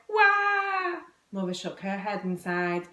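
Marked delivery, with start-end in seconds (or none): 0.1-1.0 long, high, loud "wah"; bawling wail. A narrator's reading. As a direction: bawl like a hammed-up crying child. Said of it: "tears streamed down".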